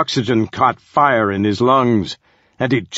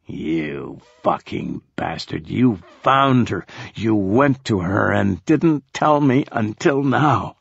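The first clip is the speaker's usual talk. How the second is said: seething curse. Acted. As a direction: spit the words at the man who hid her. Marco curses Osborne.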